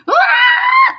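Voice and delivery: sudden high crying scream; screaming while crying. Shown as a demonstration. Teacher demonstrating a crying girl's scream for the kids, high wailing shout. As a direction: let out a wailing cry, high and loud. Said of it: "Try nyo nga, sumisigaw habang umiiyak".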